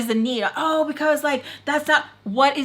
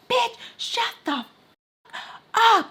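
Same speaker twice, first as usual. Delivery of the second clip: much higher, slow mock screamed insult; retold yell; 13 semitones higher than usual. To Barry after her scolding, a high drawn-out yell.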